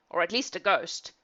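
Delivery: ordinary delivery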